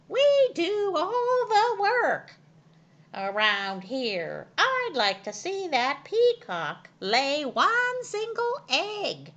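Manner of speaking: high, loud hen voice, slow start; hens shouting. A narrator's reading. Hens complaining loudly about the peacock. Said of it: "they shouted".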